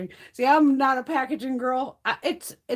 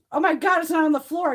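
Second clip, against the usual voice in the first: higher, louder mock alarm; imitated alarm; 7 semitones higher, 5 dB louder than usual. Friend finding the spider gone from the floor, mock startled. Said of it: "And she goes".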